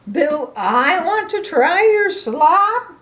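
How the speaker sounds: high, loud, slow incredulous echo; horrified at the offer; aghast Gerald. Acted. Grandma voicing Gerald appalled at Piggy's offer.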